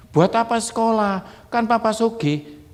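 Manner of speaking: lightly voiced spoiled child dragging it out; a rich kid whining about school. Acting lazy rich child.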